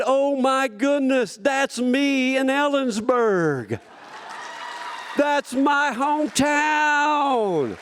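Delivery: proud cry to the rodeo crowd; rising excitement. Real, not acted. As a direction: swell to a joyful shout on "hometown". Emotional climax of speech.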